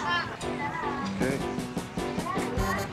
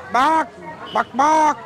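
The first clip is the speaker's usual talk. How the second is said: dog barking; 9 semitones higher, slower than usual. Pretend.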